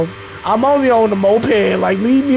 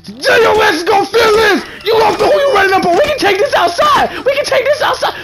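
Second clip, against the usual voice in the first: much higher, loud, fast; fired-up threats; 12 semitones higher, 3 dB louder than usual. Real, not acted. A streamer hyping a fight with Mo, shouting. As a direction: pump up for a fight.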